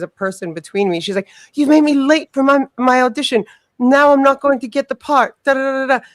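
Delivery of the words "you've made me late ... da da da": high-pitched, indignant road-rage impression; hammed-up furious rant, pitched well up; mock screaming. Retelling a late driver blaming her, mock screaming. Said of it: "I just see this person screaming".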